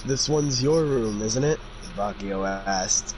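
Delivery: ordinary talk, nothing marked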